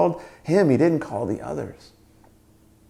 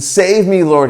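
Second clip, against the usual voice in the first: loud, higher cry for rescue; shouted plea; 5 semitones higher, 10 dB louder, slower than usual. Acted. Peter sinking in the storm calls to Jesus, shouting. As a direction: shout out desperately for help. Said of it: "he shouted".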